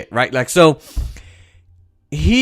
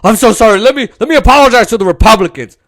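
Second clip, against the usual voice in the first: much higher, louder simpering apology; mock groveling Biden; 8 semitones higher, 7 dB louder, faster than usual. Mocking Biden apologizing to Republicans, simpering voice. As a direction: grovel it theatrically. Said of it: "He's not responsive to Democrats".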